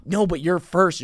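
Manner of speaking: mock-alarmed and emphatic; retold urgent correction. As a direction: press the point urgently, as if quoting. Texter urgently corrects the late comic.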